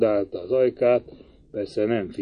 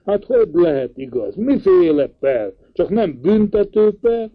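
alarmed questioning; 7 semitones higher than usual. A narrator's reading. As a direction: fire the questions at him in alarm. Alarmed uncle interrogates K.

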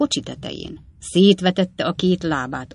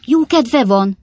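loud, higher outraged echo; indignant exclamation; 6 semitones higher, 7 dB louder, slower than usual. A narrator's reading. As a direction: throw his words back at him, appalled. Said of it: "kiáltott fel Pickwick úr". (felháborodott felkiáltás)

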